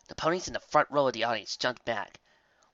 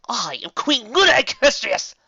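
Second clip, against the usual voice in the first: loud, high royal announcement; villainous self-coronation; grand proclamation; 13 semitones higher, 12 dB louder than usual. A narrator's reading. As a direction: declare yourself to the crowd. Dark queen proclaims herself.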